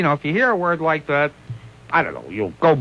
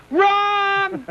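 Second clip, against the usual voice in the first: megaphone yell. Retold or imitated. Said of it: "Dom DeLuise goes to the megaphone".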